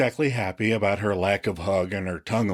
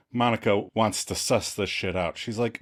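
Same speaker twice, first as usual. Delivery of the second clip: ordinary delivery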